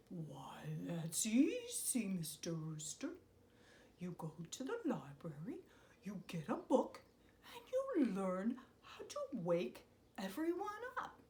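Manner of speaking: unvoiced wise whisper; owl whisper. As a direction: confide the secret softly.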